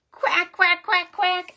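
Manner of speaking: loud, high quacks; duck quacking. Shown as a demonstration. Storyteller showing children a duck's sound, loud high quacking. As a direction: quack sharply in an even run. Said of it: "What sound does a duck make?".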